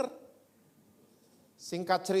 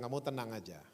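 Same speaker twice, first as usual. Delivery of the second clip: calm reassurance; 4 semitones lower, 7 dB quieter than usual. Retold or imitated. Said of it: "cuma bilang".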